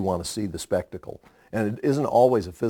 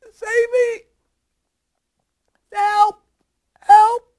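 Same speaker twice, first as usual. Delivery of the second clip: much higher, imitated panicked cries for help; mock cry for help; 7 dB louder, much slower than usual. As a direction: squeal for help like the choking boy. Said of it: "was going".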